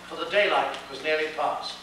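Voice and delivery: ordinary delivery, nothing marked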